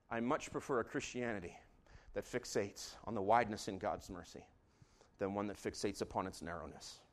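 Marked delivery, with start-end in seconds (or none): none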